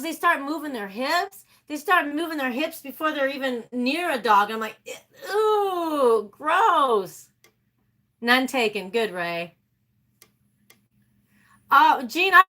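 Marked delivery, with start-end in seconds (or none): 5.3-7.3 high, drawn-out disgusted squeal; acted disgust. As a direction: recoil with an exaggerated grossed-out voice. Acting out her disgust.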